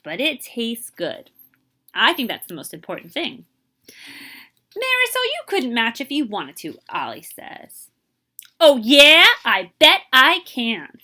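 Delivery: lively, expressive picture-book reading; teacher reading a storybook to young kids; reading to children. Acted. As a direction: read it aloud to a class, bringing each line to life. Teacher reads picture book aloud.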